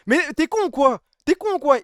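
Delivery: high, loud mock outrage. Streamer yelling at a donor in mock indignation. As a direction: yell in fake indignation at the donor.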